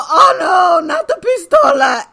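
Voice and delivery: very high, loud playful wail; mock alarm. Playing along with the angry man's joke, high loud mock panic. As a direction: cry out in hammed-up panic. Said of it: "And I go".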